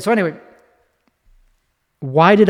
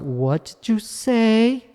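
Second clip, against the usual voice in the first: "What did you say?" slow, with the pitch raised at the end; rising end pitch; 8 semitones higher, slower than usual. Shown as a demonstration. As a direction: lift the pitch on the last word, slowly. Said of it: "raising of the pitch at the end".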